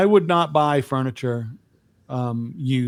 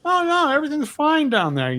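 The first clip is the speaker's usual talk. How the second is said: much higher voice, mimicking an excuse; imitated breezy denial; 12 semitones higher than usual. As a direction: imitate someone brushing off a problem. Voices son denying the mess.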